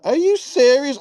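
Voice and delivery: high-pitched incredulous question; retold indignant disbelief; mock disbelief. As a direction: act out baffled disbelief, pitch jumping up. Acted disbelief at feeble attackers.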